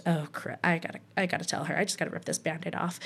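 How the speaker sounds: quick, soft self-talk; hushed realization. Retold or imitated. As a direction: think aloud under your breath, hurriedly. Retold inner realization before calling mom.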